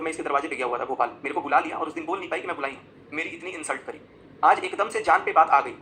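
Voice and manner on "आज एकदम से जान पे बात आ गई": loud, indignant outburst; aggrieved and raised voice; real indignation. Real, not acted. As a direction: protest loudly, stretching out the grievance. Upset over insult to his mother.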